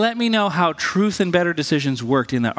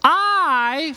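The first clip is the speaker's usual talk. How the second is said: higher, slow, pleading mock outburst; a half-acted cry of protest pitched up high; mock rage at God; 10 semitones higher, much slower than usual. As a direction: act out railing at God, without real anger. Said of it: "when we rage at God".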